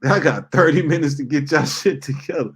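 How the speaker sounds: half-laughing warning to the crew; laughing ultimatum. Retold or imitated. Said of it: "I told them people".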